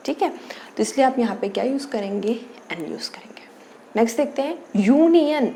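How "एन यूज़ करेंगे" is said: whisper. Real, not acted. Teacher answers own question.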